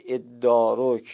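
slow ادارک, "eddārak" with long dd; ادارک with doubled d. Shown as a demonstration. Teacher contrasting ادارک with تدارک slowly. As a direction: draw out ادارک and hold the dd.

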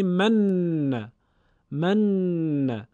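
"من" with the n held long before a final short a; emphatic doubled n. Shown as a demonstration. Teacher giving an example word, long emphatic ن in "من". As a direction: hum long on the ن in "من", then release into "a". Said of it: "حرف مشدد با تأکید و کمی مکس".

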